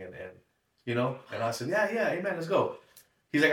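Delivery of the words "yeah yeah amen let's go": quick, high, keen agreement; eager yes. Retold or imitated. To a friend inviting him over, eagerly agreeing. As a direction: jump at the invite, higher.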